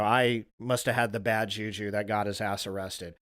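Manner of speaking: ordinary delivery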